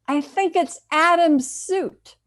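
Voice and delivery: put-on high, loud, slow child's voice of wonder; astonished young boy. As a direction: play a small boy amazed by his discovery. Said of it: "With astonishment in his young voice".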